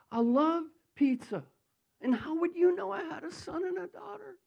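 astonished, high-pitched retort; high, incredulous wonder; retold amazement. Retold man amazed by prophecy.

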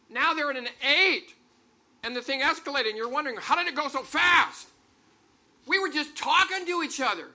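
loud, high, escalating preacher's shout; heated escalation. Acted. Preacher acts out an escalating argument.

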